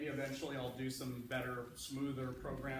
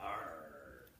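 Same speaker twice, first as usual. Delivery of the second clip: low growling pirate; deep gruff sea pirate; 6 semitones lower than usual. Acted. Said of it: "Can I make the pirate voice?".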